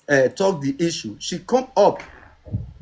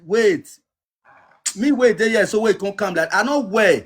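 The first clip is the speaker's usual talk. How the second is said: raised, higher voice pleading wait; urgent raised pleading to hold on; 5 semitones higher than usual. Real, not acted. Heated argument, begging S3 to wait.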